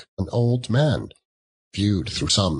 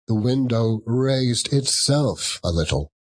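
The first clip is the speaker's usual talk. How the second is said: plain reading voice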